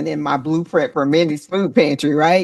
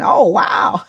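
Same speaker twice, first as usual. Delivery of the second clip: much higher and louder, a cry of delight; real excited outcry; 5 semitones higher, 5 dB louder than usual. Real, not acted. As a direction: let the surprise burst out of you. Moved by a listener's comment.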